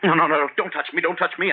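high, frightened protest; panicked pleading. Acted. Frightened man begging the stranger not to touch him, high panic. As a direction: shrink from his grip in panic.

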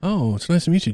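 ordinary talk, nothing marked

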